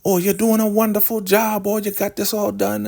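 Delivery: high, sugary mock praise in a falsetto; hammed-up high matriarch voice; mock Elder Mother. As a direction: gush like a smug cult matriarch, way up high. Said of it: "here comes Elder Mother Marva".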